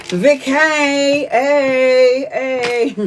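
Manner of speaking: high, stretched-out hailing; playful calling out. Real, not acted. Reader hailing Vicky for her reading, playful call-out.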